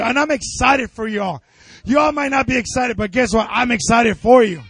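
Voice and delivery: high, loud, shouted preaching; full-force preaching. Acted.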